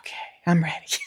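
breathy whisper, settled and ready; calmed-down whisper. Retold or imitated. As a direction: whisper it as if your husband just calmed you. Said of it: "real calming voices that kind of go like that".

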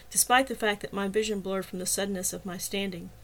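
usual speaking voice

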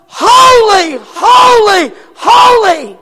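high, loud hollered call; hollering holy. Acted. One line of angels hollering to the other. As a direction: holler it across heaven. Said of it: "one side hollers".

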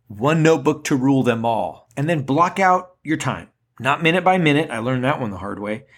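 ordinary talk, nothing marked

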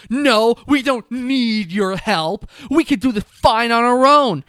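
loud, slow, high huffy refusal; a hammed-up proud snub; indignant refusal. Girls rejecting help, acted.

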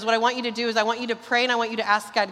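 ordinary delivery

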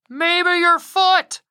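high, slow heckling yell; cheeky yelled heckle. Acted. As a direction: shout it from the back of the crowd. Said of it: "someone in the back of the entourage yelled".

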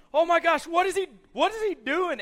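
high, loud disbelief; astonished crowd shout. Retold or imitated. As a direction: cry out like a stunned crowd. Retold crowd's astonished outcry.

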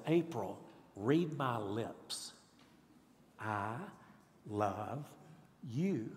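slow, low and soft, word by word; tender father. Acted. Father down over his baby daughter saying he loves her, tenderly.

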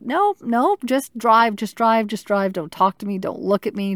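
high, loud desperate urging; urgent panicked plea. Retold or imitated. To husband at the wheel, frantic high urging. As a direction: beg him to just drive, frantic.

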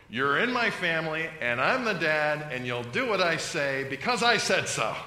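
put-on loud, bossy dad pointing at his kids; dysfunctional father. Preacher acting a dysfunctional dad, loud and bossy. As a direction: lay down the law like a domineering father. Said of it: "if I was a dysfunctional father".

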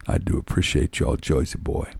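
soft, subdued thanks; a touch of weary gratitude; lightly voiced beaten fan. A narrator's reading. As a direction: say it quietly, with the fight gone. Voicing beaten, vanquished Harold.

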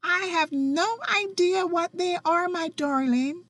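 cheerful Aunt Sonia. Retold or imitated. Quoting her cheerful aunt answering the phone, high and bubbly. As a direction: chirp it out like a sunny auntie. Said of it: "She laughed and she said".

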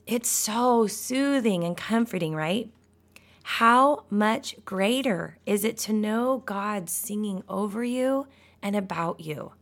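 usual speaking voice

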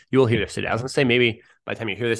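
ordinary talk, nothing marked

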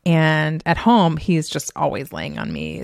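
ordinary talk

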